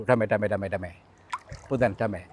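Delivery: low, hushed "damai, damai" to calm it; soft pleading. Real, not acted. Explorer softly begging the creature for peace, hushed. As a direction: murmur peace offerings, careful not to provoke.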